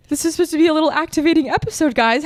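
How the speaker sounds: high, loud, tearful rush of words; fighting back tears, speeding up; overcome with emotion. Real, not acted. Podcaster admitting to listeners she's moved, tearful. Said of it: "literally getting so emotional".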